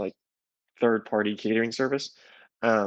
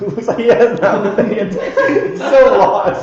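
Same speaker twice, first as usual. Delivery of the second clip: higher, louder, laughing hard; 9 semitones higher, 11 dB louder, slower than usual. Real, not acted. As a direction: laugh through the words at him. Laughing at spaced-out friend.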